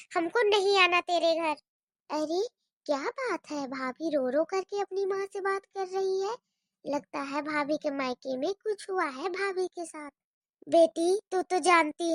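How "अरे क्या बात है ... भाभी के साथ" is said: much softer, lower, secretive aside; overheard aside. Acted. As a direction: murmur it to yourself so no one hears. Bua gossips to herself, overhearing. (धीमे स्वर में फुसफुसाती हुई बात)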